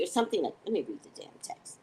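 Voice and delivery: normal talking voice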